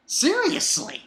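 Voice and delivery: high, falling disbelief; incredulous. Acted. Reader reacting to angry Cohen, incredulous. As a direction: pitch it way up, exasperated.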